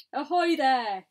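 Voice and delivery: loud hail to someone far above; commanding shout. A narrator's reading. Police chief hailing the travellers on top, commanding shout. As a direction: call up to them at full voice. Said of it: "shouted the chief of police".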